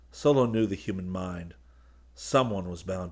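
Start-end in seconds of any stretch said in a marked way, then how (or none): none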